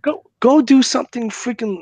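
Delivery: high, loud go do something; exasperated venting. Real, not acted. Man venting about prank callers, exasperated and high. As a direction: push them off, fed up.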